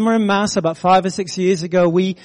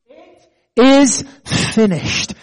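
slow, weighty proclamation, pitched higher; retold cry; 4 semitones higher, much slower than usual. Preacher quoting Jesus on the cross of Calvary, slow retold cry. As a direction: draw out each word of the quoted cry. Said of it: "He cried out on the cross".

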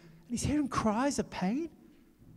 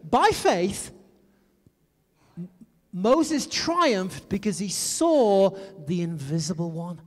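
louder, slower, deliberate recital; scripture repeated with emphasis; 3 dB louder, slower than usual. Shown as a demonstration. Pastor rereads the verse to the congregation, slow and weighty. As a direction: lean on each word of the verse. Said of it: "Let me say that again".

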